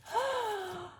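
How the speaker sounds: sharp gasp of shock. Acted. Girl gasps at the imp's price, shocked. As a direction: gasp in at the news. Imp demands first child.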